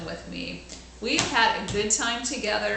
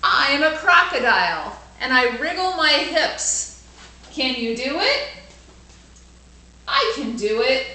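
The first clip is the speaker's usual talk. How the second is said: put-on bright, louder storybook reading for kids; lively read-aloud; 4 semitones higher, 7 dB louder than usual. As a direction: read it out big for the class.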